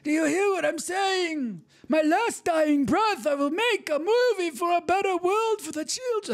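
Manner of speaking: high, cracked old-man impression of Coppola; mock Coppola. Vlogger parodying Coppola's speech, hammed-up high old-man voice. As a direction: ham up a frail old director pleading.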